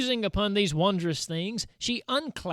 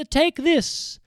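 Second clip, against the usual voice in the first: higher, kind offering voice; kindly offered; 8 semitones higher, 5 dB louder than usual. A narrator's reading. Undine offering Bertalda the necklace, kindly. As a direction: offer it gently like a gift. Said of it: "holding it out kindly to Bertalda".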